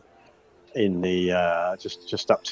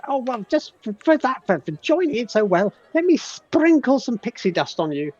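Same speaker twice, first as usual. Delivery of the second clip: pixie voice; 11 semitones higher than usual. Acted. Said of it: "so he says".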